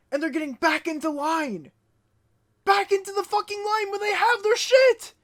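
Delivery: high, loud outraged rant; furious, high-pitched outburst; angry yelling. Real, not acted. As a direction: yell it in real exasperation. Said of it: "I know I'm yelling".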